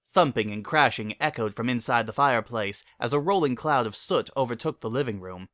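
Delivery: ordinary delivery, nothing marked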